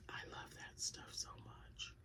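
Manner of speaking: slow breathy whisper of love; hushed, slow, devoted whisper. Real, not acted. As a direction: whisper it like a secret treat. Loving aside about spices.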